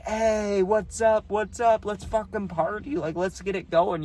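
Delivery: high, rowdy party shout-out; drunk hype greeting. Retold or imitated.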